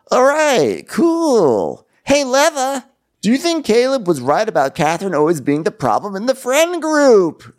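high, chirpy host voice; mocking the host baiting a guest; mock Andy. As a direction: play a gleeful talk-show host stirring the pot. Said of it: "And Andy's like".

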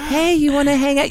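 high, light friendly voice for animals; high-pitched coaxing of a pet; sweet talk. Shown as a demonstration. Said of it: "when you talk to something sweet".

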